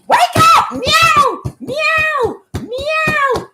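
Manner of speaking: be the cat crying at the door to wake her owner; cat voice yelling. Retold or imitated. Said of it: "my baby, she come to my door".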